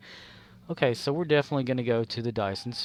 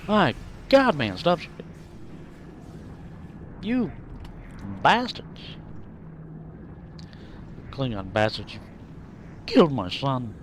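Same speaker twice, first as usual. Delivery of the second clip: mock outrage. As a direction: rage dramatically at the enemy.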